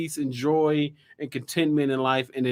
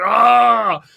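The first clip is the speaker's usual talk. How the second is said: frustrated yell; 6 semitones higher, 9 dB louder than usual. Retold or imitated. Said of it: "I'm like".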